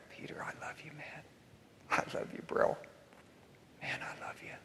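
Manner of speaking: very soft, whispered affection; tender whisper. Acted. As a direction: whisper it lovingly to a failed friend. Jesus's imagined loving look at Peter.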